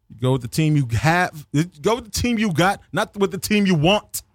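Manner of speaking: normal talking voice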